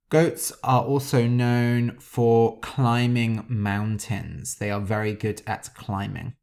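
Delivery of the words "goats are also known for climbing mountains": usual speaking voice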